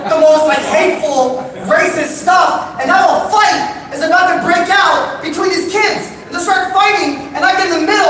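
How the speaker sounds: high, loud incredulous rant; mock outrage. Real, not acted. Comedian telling the crowd, high and loud outrage. Comic outrage at racist kids.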